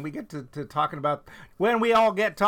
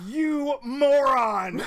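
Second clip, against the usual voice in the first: loud, slow, drawn-out insult; exasperated shout; 8 semitones higher, 5 dB louder, much slower than usual. Pretend. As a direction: stretch it out in mock outrage. Mock outrage at Hudson.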